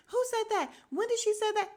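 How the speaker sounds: hammed-up high-pitched shock; imitating Drew. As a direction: squeal the questions as if clueless. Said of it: "then Drew is like".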